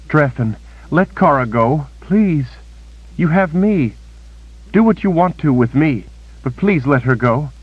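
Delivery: high, trembling-edged begging; high pleading voice, offering herself; desperate pleading. A narrator's reading. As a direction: plead with the captor for her friend. Kalen begs captor for friend.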